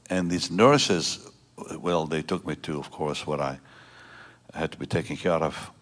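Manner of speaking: ordinary delivery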